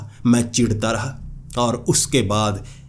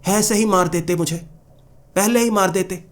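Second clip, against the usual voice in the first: higher, exasperated cry to the sky; despairing plea to God; 8 semitones higher than usual. Retold or imitated. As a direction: complain to God you'd rather be dead. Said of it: "भगवान को कह रहा".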